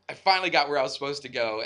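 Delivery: ordinary talk, nothing marked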